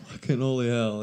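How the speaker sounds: usual speaking voice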